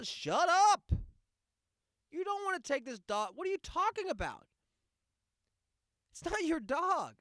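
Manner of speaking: high, loud snapping back at a gusher; angry retort. Real, not acted. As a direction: shut the fake fan down hard.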